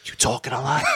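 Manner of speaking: imitating the friend's breath-only phone voice; forced whisper, on the edge of laughing; talking real low. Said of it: "like, talking real low".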